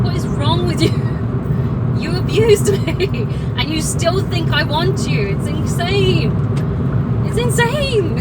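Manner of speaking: loud, incredulous, laughing in disbelief; angry disbelief. Real, not acted. Telling off her abusive ex, loud laughing disbelief. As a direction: fling it at him, half laughing, half furious.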